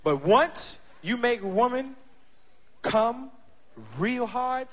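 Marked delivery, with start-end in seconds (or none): none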